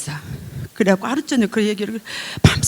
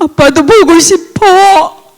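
slow, high, loud tearful plea; tearful longing; 8 semitones higher, 9 dB louder, much slower than usual. Real, not acted. Daughter tells father she misses him, crying. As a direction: ache for him slowly.

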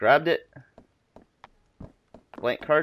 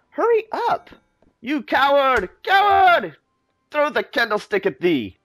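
much higher, louder taunt; high scornful shout; 11 semitones higher, 4 dB louder than usual. Acted. Villain taunting the scientist, high loud scorn. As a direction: spit out coward.

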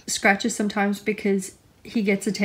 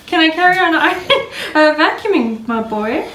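laughing question; 7 semitones higher, 3 dB louder than usual. Real, not acted. Laughing while asking pig.

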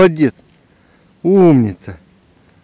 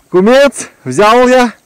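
ordinary talk, nothing marked

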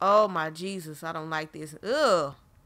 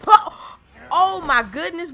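sudden loud shriek, higher; startled scream; 8 semitones higher, 10 dB louder, slower than usual. Real, not acted. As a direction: yelp from a real fright. Jump scare in horror game.

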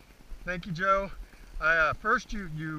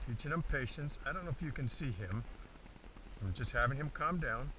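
usual speaking voice

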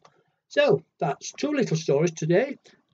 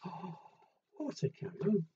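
plain reading voice

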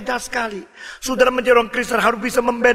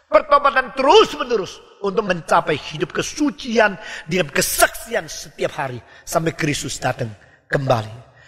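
ordinary talk

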